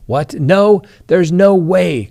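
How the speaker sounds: high, loud and slow, acting out the wife's shock; a retold gasp of no way, drawn out; wife hearing a confession, stunned disbelief.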